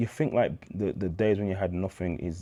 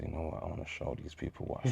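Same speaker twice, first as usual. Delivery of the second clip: breathy near-whisper, much softer; hushed inner thought; 3 semitones lower, 11 dB quieter than usual. Retold or imitated. At the bar, talking himself into splashing out, near-whispered. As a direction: murmur it to yourself, scheming.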